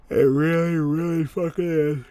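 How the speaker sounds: loud, high yawning voice; real yawn stretching out the words; talking through a yawn. Real, not acted. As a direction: let a big yawn swallow the words. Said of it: "Sorry, I'm yawning".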